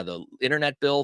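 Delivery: ordinary talk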